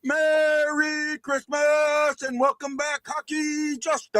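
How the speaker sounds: high, slow, stretched-out welcome; enthusiastic opening. Real, not acted. Host welcoming co-host back to the show, enthusiastic. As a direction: draw out every word like a big show greeting. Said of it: "After my enthusiastic opening".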